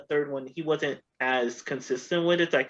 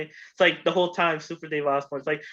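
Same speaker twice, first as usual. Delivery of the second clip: normal talking voice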